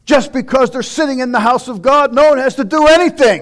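rising preaching. Acted. Preacher lists the delivered to the congregation, loud. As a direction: hammer each deliverance home.

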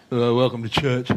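low-pitched comic welcome at the door; mock greeter, voice dropped for laughs. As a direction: play a church greeter in a put-on low voice. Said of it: "greeting people on the door with a smile".

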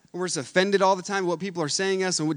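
ordinary talk, nothing marked

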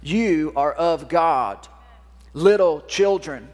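lightly voiced God, slow and weighty; a hint of a fatherly God, drawn out; slow, solemn voice of God. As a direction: speak as God to his child, slow and grand. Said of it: "This is God speaking to you this morning".

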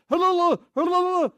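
very high, loud rapid wail; imitated hysterical high-pitched yelling; frantic cry. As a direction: scream it desperately in a garbled rush. Man repeating his desperate cry.

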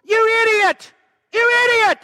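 furious high shouted insult; angry yell. Retold or imitated.

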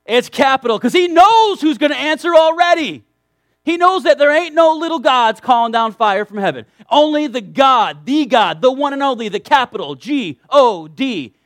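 loud, rising preacher's cry; emphatic preaching. Real, not acted. Preacher to congregation, loud emphatic proclamation. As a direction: drive the point home with force.

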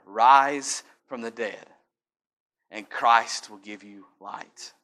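each word slowed and pressed down; slow, weighty, like a command over the graves; slow emphatic reading. Real, not acted. Slow scripture climax.

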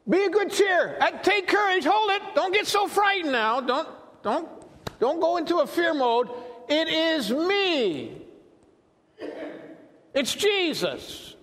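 voicing Jesus. Jesus to frightened disciples in the boat, calling out high. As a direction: holler to them as if across water, acted. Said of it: "Jesus spoke to them, saying".

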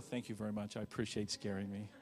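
soft, low, dry sarcastic thanks; mock politeness after a scare. Turning to the prankster, quiet sarcastic thanks. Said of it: "You turn around and go".

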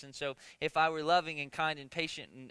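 normal talking voice

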